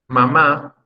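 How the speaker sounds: Mamá, weight on the closing MÁ; stress on last syllable. Shown as a demonstration. Teacher explaining the word for mother, Mamá stressed on the last syllable. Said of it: "Es en la última sílaba".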